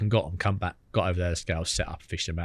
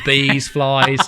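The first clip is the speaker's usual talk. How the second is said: usual speaking voice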